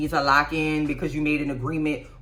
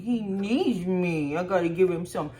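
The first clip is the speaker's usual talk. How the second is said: mock doting mom voice. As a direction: drag it out like a mom fussing over her baby. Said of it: "you just gonna be like".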